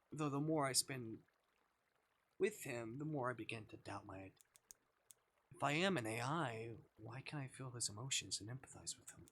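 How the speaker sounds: normal talking voice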